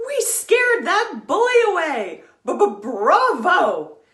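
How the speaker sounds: high loud stuttered cheer; triumphant cheer. Acted. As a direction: crow in triumph. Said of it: "Bismarck cried".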